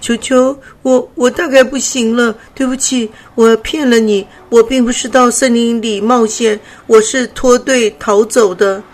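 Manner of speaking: the dying butterfly confessing to the cub, trembling; trembling confession. Acted. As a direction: stammer out the truth while shivering. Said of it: "灰灰不停颤抖着说".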